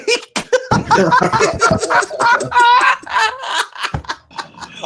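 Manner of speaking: high, helpless shrieking laughter; loud laughing fit. Real, not acted. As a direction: lose it completely. Bursts out laughing.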